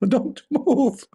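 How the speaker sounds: high, mock commanding "Don't move."; neighbor's order. Neighbor with shotgun ordering the intruder, hammed-up high. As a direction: bark it like a gun-holding neighbor.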